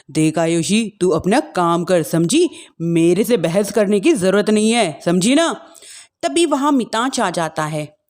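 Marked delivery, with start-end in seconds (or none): 0.1-5.7 lower, stern warning matriarch; menacing older woman; wicked stepmother. Acted. Mother-in-law threatens bride.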